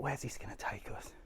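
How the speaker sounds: breathy whisper to himself. Real, not acted. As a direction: whisper it under your breath. Muttering to himself on a walk.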